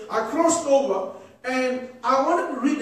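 ordinary delivery

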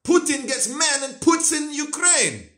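high put-on Russian accent, Putin's pitch; Putin's line in a hammed-up Russian accent; mock Russian accent. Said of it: "Instead of saying".

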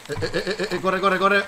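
high, loud panicked calls; urgent yelling to hurry; panicked urging. Real, not acted. Shark attacks, urging himself on.

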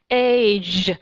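age with a long, heavy buzzing J; wrong-way age, final sound pushed too hard; overdone ending sound. Shown as a demonstration. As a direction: overpronounce the J in age as a loud "zhh". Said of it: "cái âm nó quá mạnh đi".

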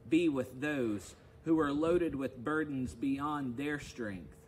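leading the faithful through the Stations of the Cross in a full, steady reading voice; liturgical reading. Acted. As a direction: read it out to the congregation like a service.

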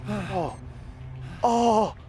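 normal talking voice

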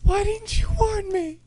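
very high, plaintive wail; squeaky, upset complaint; whining reproach. Real, not acted. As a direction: whine it accusingly at a friend. Blames partner after scare.

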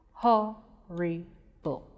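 "Horrible" slowly split into three separate syllables. Shown as a demonstration. Teacher breaking "Horrible" up for pupils, slow and separated. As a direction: break "Horrible" apart, pausing after each syllable. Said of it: "When we break it".

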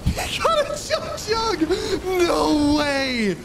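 high, cracking shout of disbelief; excited shout. Real, not acted. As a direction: yell out in stunned excitement. Teammate survives with Chug Jug.

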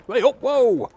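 high, loud startled cry; startled shout. Real, not acted. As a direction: yelp as the birds snatch it. Gulls grab his food.

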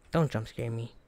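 usual speaking voice

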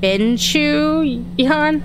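BINCHU YAN very slow, lifting high at the end; halting sounding-out. Real, not acted. Player struggling to pronounce a name on screen, slow and unsure. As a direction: feel your way through an unfamiliar name. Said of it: "Why can I never freaking pronounce this?".

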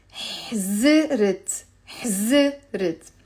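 slow حزرت with ħ and a short vowel after z; syllable drill. Shown as a demonstration. Teacher drills students on حزرت slowly. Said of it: "it's two syllables".

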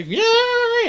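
high-pitched sustained "yay"; mock crowd cheer, way up high; wave cheer. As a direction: squeal out a pretend stadium cheer. Acting out a stadium wave.

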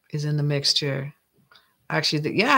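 usual speaking voice

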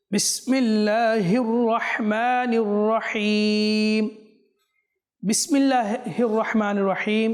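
slow melodic Quranic chant with drawn-out vowels; slow Quran recitation. Shown as a demonstration. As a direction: recite it slowly in tajweed. (ধীর সুরেলা কুরআন তিলাওয়াত)

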